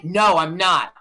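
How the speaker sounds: mock firm refusal down the phone; refusing actor. Acting out Keitel's refusal.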